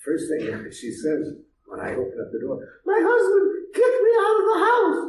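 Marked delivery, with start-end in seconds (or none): 2.8-5.0 much higher, louder distressed woman; upset pregnant woman. Retold or imitated. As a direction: play the frantic woman at the door. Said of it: "she's crying her heart out".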